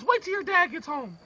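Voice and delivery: high mother voice warning; threatening mom. Acted. Mom threatens through door.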